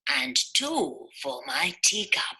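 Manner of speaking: lightly voiced gentle old man; a hint of a mild old zookeeper; Amos voice.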